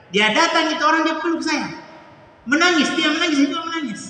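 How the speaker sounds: high, loud, choked-up retelling of the tearful embrace; overcome with emotion. Real, not acted. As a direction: relive the hug, voice lifting to a cry. Retelling embrace and weeping together. (terharu, suara tinggi dan keras)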